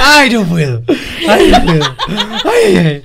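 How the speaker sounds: real, helpless laughter; breathless from laughing; laughing fit. Real, not acted. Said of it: "Respirá".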